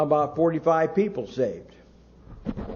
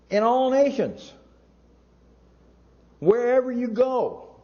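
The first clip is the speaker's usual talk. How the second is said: rising preacher's cry; 7 semitones higher than usual. Acted. Preaching climax.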